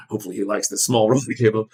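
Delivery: quick, laughing mock of a condescending teacher; mocking teacher quote. Student imagining teachers patronizing him, fast and laughing.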